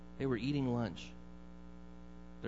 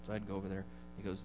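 usual speaking voice

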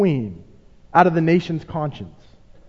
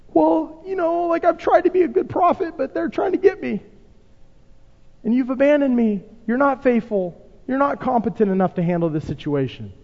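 Elijah's complaint; 6 semitones higher than usual. Acted. Elijah answers God, whining.

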